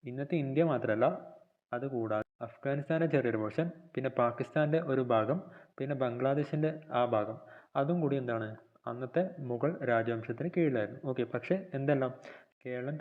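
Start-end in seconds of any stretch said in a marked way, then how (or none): none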